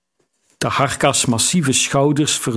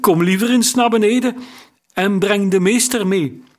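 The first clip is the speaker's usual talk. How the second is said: high-pitched, unsettled summons; rattled sailor's high-pitched shout for help; unsteady call; 7 semitones higher than usual. A narrator's reading. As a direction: call up to the captain, voice high and unsure. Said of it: "riep hij met onvaste stem".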